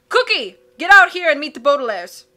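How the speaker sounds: loud call through a door. Acted. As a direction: call to someone in the next room. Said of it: "called inside".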